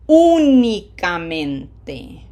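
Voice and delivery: Únicamente very slow, with a loud stretched Ú; slow, emphatic teacher demo of Únicamente; long, strong vowels. Shown as a demonstration. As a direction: punch the Ú of Únicamente and draw out every vowel. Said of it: "long, strong vowels".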